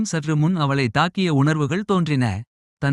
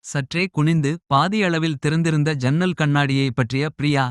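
plain reading voice

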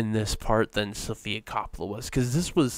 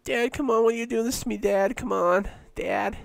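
put-on much higher, nervous whining teen; Morty-like whine; 12 semitones higher than usual. Comparing an actress's line to a cartoon teen, high whine. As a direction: whine it like a panicky cartoon kid. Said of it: "she kinda reminds me of, like, Morty".

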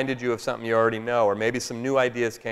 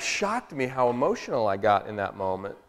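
ordinary talk

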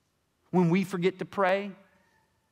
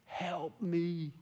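lightly voiced scared little boy calling out; a hint of a frightened boy crying Daddy; child's cry for help; 5 semitones higher, much slower than usual. Father retelling his son stuck on the net, mild crying. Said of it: "And here's his cry".

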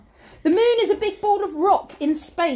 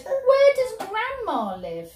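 sly wolf; 6 semitones higher, slower than usual. Acted. Wolf voiced in storytelling.

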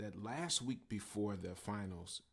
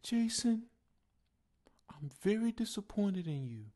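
high, breathy whispered disappointment; high hushed teacher voice, heavy with disappointment; teacher's disappointed voice; 12 semitones higher, 7 dB louder than usual. Retold or imitated. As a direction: sigh it out like a let-down teacher. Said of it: "and his voice was like this".

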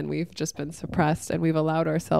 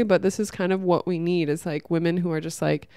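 ordinary talk, nothing marked

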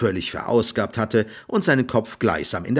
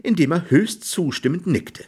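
ordinary delivery

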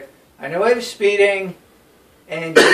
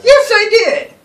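higher, louder indignant yes; emphatic outburst; 14 semitones higher, 10 dB louder, slower than usual. Real, not acted. Answering a friend's teasing, loud emphatic insistence.

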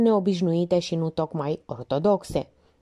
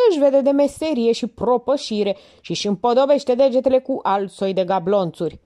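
higher, louder impatient lecture; Minerva, exasperated; 7 semitones higher, 4 dB louder than usual. Acted. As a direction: lecture the absent-minded lieutenant.

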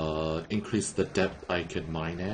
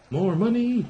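excited; 14 semitones higher, 4 dB louder than usual. Real, not acted. Excited about earning cash.

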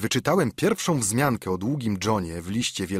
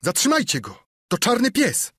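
high, loud alarmed yell; boy shouting to stop the fugitive; urgent shout; 13 semitones higher, 4 dB louder than usual. Acted. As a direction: raise the alarm across the inn. Boy shouts after fleeing man.

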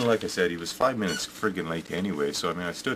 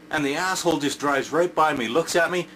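usual speaking voice

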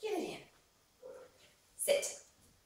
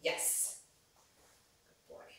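ordinary talk

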